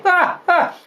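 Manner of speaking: high, short cackle; gleeful laugh. Acted. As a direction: laugh at your own joke. Nurse laughs at her story.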